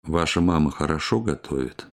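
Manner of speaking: ordinary talk, nothing marked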